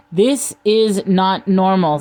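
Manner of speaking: "This is not normal" slow, each word separate; word-by-word emphasis. A narrator's reading. Millennial clapping between words, slow separated "This is not normal". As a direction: punch out "This is not normal" one word at a time. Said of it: "clapping between each word".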